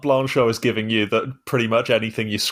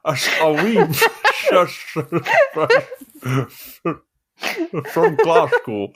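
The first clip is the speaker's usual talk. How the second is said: laughing through a much higher mock Glasgow voice; Glasgow accent attempt; 6 semitones higher than usual. Shown as a demonstration. Asked by a friend to try again, a high, laughing mock Glasgow accent. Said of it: "See, give it a go".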